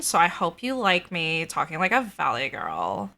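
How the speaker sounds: usual speaking voice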